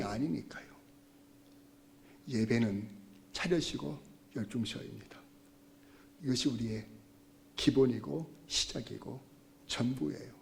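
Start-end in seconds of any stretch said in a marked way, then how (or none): none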